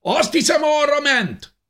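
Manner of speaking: high, loud soldier's shout; shouted direction. A narrator's reading. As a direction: call the others after him.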